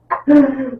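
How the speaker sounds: high, loud imitated cackle; mocking laugh. Imitating the wife laughing at him, mocking laugh. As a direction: mimic a jeering burst of laughter. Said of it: "So she's laughing at him".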